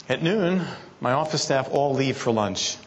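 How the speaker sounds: normal talking voice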